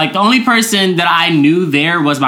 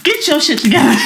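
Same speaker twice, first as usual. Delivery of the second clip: high-pitched exasperated retort; playfully acted exasperation; mock scolding; 10 semitones higher than usual. Retold scolding of the man.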